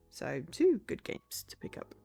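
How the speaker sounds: unvoiced whisper. Real, not acted. Streamer whispering a quick game tip. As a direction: whisper it as an aside.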